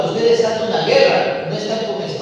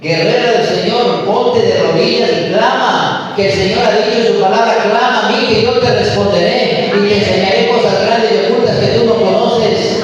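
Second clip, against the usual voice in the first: loud commanding sermon call; preacher urging the woman of God to cry out loud; full-force preaching; 4 semitones higher, 4 dB louder than usual. Acted. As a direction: rouse the woman to pray, loud.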